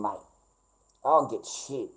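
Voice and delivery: ordinary talk, nothing marked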